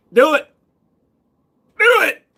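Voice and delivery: loud, high-pitched dare, building; escalating goading yell. Acted. Daring a viewer to donate, escalating mock yell.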